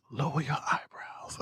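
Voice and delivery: slow, hushed whisper; whispered tip. Retold or imitated. Matthew giving a makeup tip in a slow whisper. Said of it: "He said".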